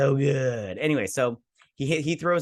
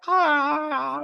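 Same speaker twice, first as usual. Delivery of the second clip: high, loud drawn-out "ah"; imitated dragon roar; 4 dB louder than usual. Fan imitates the dragon sound they love, high roar. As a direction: mimic a dragon's cry in one long high note. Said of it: "how did that go again".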